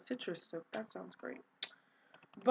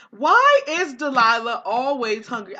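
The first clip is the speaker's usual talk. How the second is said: loud exasperated question; 2 semitones higher, 11 dB louder than usual. Real, not acted. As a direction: throw up your hands, loudly. Exasperated about hungry Sim.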